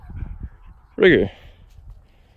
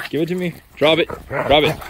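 ordinary talk, nothing marked

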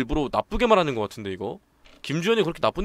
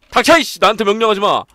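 furious shout; 7 semitones higher, 11 dB louder than usual. Acted. Enraged character shouts.

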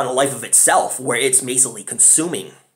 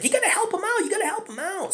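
high, urgent woman's plea, fading softer; Becky pleading; 4 dB quieter than usual. Retold or imitated. Pregnant sister urges her brother to save the boy, high and urgent. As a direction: beg her brother to go in after the kid. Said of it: "Becky's like".

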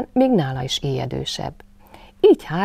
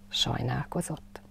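plain reading voice

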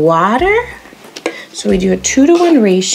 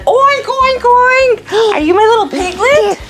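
piggy voice; 10 semitones higher than usual. Pretend. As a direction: play a piglet rolling in mud. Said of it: "act like piggies together".

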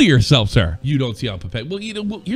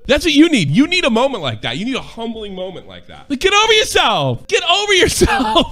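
loud and higher, hammered home; retold loud rant; 7 semitones higher, 5 dB louder than usual. Retelling the pub lesson to himself, loud and higher. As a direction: preach it loud at yourself. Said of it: "And I was like".

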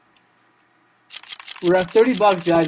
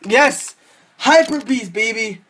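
sudden higher, louder burst of triumph; real jubilant outburst; excited cheer; 4 semitones higher, 4 dB louder than usual. Real, not acted. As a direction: cheer the lucky win, easing on the last word. Lucky case drop, celebrating.

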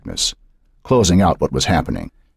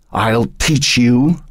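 very slow, higher threat; menacing rasp; 7 semitones higher, much slower than usual. A narrator's reading. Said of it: "the voice rasped".

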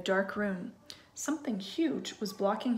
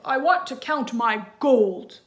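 louder, slow giant voice; giant's demand; 2 semitones higher, 9 dB louder than usual. Acted. Giant telling his wife he wants his gold, booming. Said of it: "he said to his wife".

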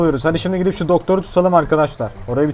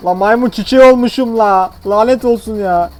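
upset sick patient; 5 semitones higher, 5 dB louder than usual. Pretend.

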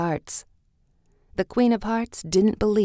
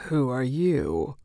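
lower, slow, languid caterpillar; 4 semitones lower, slower than usual. A narrator's reading. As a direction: drawl the question lazily from the hookah. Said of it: "the caterpillar asked".

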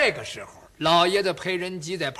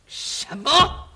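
shocked cry; 7 semitones higher, 5 dB louder than usual. Acted. 裴元庆震惊.

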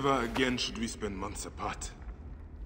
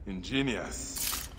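slow; 2 semitones lower, much slower than usual. Acted. Impressed by the plan.

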